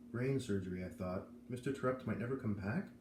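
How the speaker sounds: usual speaking voice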